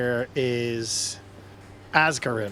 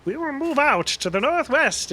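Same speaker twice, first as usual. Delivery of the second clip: high, loud, hammed-up funny voice; rally the troops in a silly high voice; Sir Ham; 11 semitones higher, 4 dB louder than usual. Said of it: "for me to do a funny voice".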